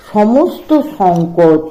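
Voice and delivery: ordinary delivery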